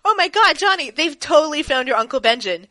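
high, loud, breathless news; excited. Retold or imitated. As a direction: blurt it out in a rush of excitement. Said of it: "he's like".

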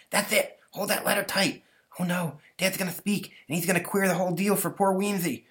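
urgent hush on the ladder; hushed boy. Acted. Stanley peeking at the meeting, high and hushed.

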